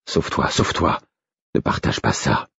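hushed, unvoiced plea; murmured. A narrator's reading. Prisoner begs wolf to flee, murmuring. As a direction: murmur it almost in a whisper. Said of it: "murmurai-je".

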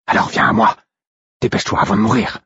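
urgent wolf. A narrator's reading. Wolf urging his brother before death, urgent rasp. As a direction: urge him on in a rough voice. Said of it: "Mon propre loup qui m'appelait ainsi".

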